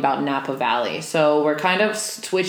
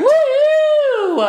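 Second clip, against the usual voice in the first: cheer; 7 dB louder than usual. Real, not acted. Cheering a right answer.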